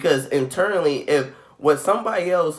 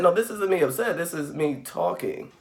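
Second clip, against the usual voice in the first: ordinary delivery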